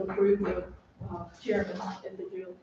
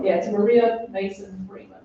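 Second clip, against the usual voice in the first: speaking louder; 9 dB louder than usual. Real, not acted. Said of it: "just say the, the name loud enough".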